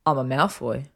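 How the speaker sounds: plain, firm statement of fact; matter-of-fact. A narrator's reading. Malfoy explains his lifestyle to Hermione, matter-of-factly. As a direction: state it evenly, as if self-evident. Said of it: "he replied matter-of-factly".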